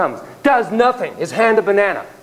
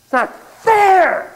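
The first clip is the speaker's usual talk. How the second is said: high, slow indignant whine; whiny outrage; 4 dB louder, much slower than usual. Acted. Founders complaining about the crown, high slow whine. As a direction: whine it like a wronged child.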